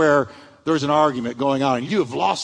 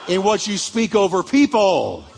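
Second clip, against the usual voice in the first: preached call-out; 3 semitones higher than usual. Real, not acted. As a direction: punch it out slowly for the congregation. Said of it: "See, everybody say".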